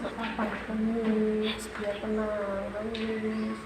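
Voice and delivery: soft and quiet, letting her cry; a soft, tender murmur of comfort; tender reassurance. Real, not acted. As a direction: hush her gently so she can let it out. Lets the crying pupil cry.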